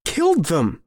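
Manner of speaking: high, appalled exclamation; horrified accusation. Acted. Shaken man accusing his friend of killing, horrified. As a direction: blurt it in disbelief, pitch jumping up.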